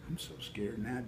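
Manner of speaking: usual speaking voice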